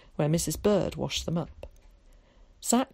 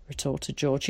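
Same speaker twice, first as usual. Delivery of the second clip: ordinary delivery, nothing marked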